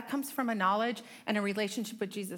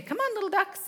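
high singsong mom call; a mother calling her toddlers to follow; calling the kids; 11 semitones higher, 3 dB louder than usual. Retold or imitated. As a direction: call the little ones along brightly. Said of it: "And I used to say".

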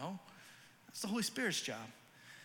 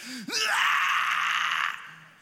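loud, high unvoiced baby screech; mock tantrum scream; toddler tantrum wail; 13 semitones higher, 10 dB louder than usual. Toddler throws a fit.

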